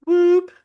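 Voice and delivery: high loud whoop again; high hooting whoop; imitated whoop call. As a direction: sound out the whoop sharply. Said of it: "it was pronounced".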